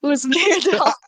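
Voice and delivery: breathless manic transatlantic voice; out of breath. Acted. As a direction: gasp out "doll" winded. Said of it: "manic out of breath".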